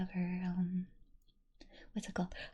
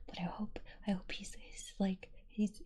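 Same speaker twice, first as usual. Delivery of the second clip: normal talking voice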